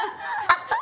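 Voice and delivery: very high squeal of laughter; shrieking laugh. Real, not acted. Laughing at the imitation.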